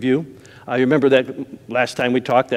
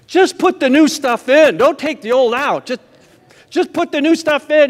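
pleading; 11 semitones higher than usual. Retold or imitated. Patient pleading with dentist.

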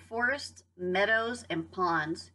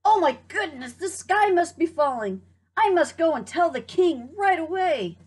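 high, louder flustered panic; panicked hen; 8 semitones higher, 6 dB louder than usual. Acted. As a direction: squawk it out in alarm. Hen panics sky is falling.

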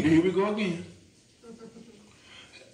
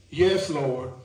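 Peter's humble answer; 6 semitones lower than usual. Acted. Peter answering Jesus, voiced.

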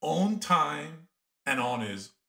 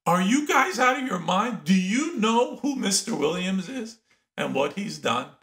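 high-pitched, exasperated outburst; retold indignant yell; 8 semitones higher than usual. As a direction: challenge them in disbelief, pitch way up. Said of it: "I wanted to yell at them".

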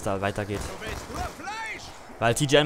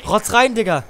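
excited yell; 8 semitones higher, 4 dB louder than usual. Real, not acted. Gamer hype mid-fight.

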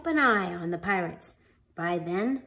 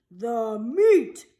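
louder roared chorus; crew roar; 4 dB louder than usual. A narrator's reading. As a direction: roar it like hungry pirates. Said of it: "we all roared".

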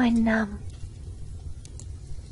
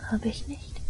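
softer whispered no; whispered denial; 7 dB quieter than usual. Acted. Girl denies murder to listener in a soft whisper. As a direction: hush it like a secret.

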